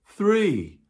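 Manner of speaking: high bright call of three; excited count. Real, not acted. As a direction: land the count with a lift.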